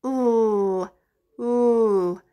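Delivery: "l" held as a long lll; letter L sound. Shown as a demonstration. Teacher answering what L says, sustained "l" sound. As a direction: hum out "l" on the tongue, no letter name. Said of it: "The L says".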